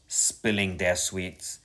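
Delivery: ordinary delivery, nothing marked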